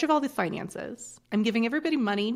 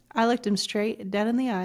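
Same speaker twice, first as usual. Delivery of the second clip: normal talking voice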